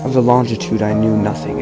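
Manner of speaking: plain reading voice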